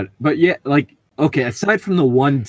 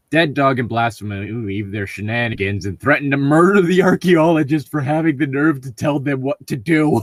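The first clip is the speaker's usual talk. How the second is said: ordinary talk, nothing marked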